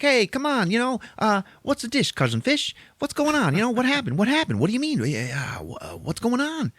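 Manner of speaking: put-on very high, chattering New York accent; little New Yorker. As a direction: pester him as a nosy little New Yorker. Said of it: "He's a fucking little New Yorker".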